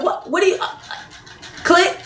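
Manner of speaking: high, baffled what after being hung up on; can't believe he just hung up; stunned disbelief. Retold or imitated. He hung up on her.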